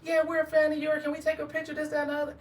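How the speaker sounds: high-pitched imitation of fans; eager fans. Guest voices mall fans asking for a picture, high voice. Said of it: "turned around and was like".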